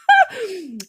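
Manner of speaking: high, loud burst of laughter; emotional laugh. Real, not acted. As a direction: laugh off the welling tears. Laughing off her own tears.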